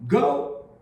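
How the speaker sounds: a sudden loud, high order; stern command. Acted. Rebbe shouting at his frightened servant to go. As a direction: bark the order to go.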